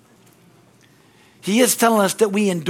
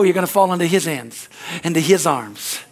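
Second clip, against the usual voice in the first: ordinary delivery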